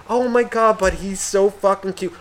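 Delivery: put-on high, cooing baby admiration; gushing relative. Relative cutting in over the scolding, gushing.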